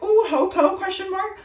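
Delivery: high, loud mocking singsong; mocking the sign. Mocking a promposal sign, high and loud. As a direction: ham up the cutesy sign. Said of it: "a big ass sign like".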